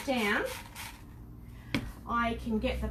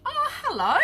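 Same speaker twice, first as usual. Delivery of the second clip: high, louder excited hello; delighted greeting; 11 semitones higher, 6 dB louder than usual. Real, not acted. Streamer greets viewer watching from Ireland, delighted. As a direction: greet them with thrilled surprise.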